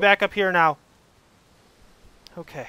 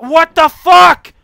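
slow, loud, higher scream; furious shout; 7 semitones higher, 10 dB louder, slower than usual. Real, not acted. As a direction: scream it in disbelief. Fall again.

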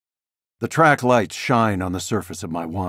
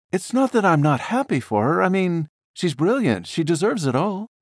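higher wistful wife, lightly voiced; a lifted, gentle woman's voice, a touch rueful; Daniela's voice; 10 semitones higher than usual. Wife praising a rival artist, higher and wistful.

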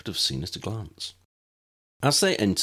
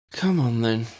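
resigned; 4 semitones higher, 3 dB louder than usual. A narrator's reading. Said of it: "with a tone of abject resignation".

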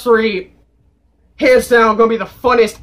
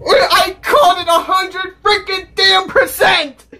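high, slow emphatic yell; triumphant shout; 9 semitones higher than usual. Real, not acted. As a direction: hammer every word out in triumph. Boasting that prediction came true.

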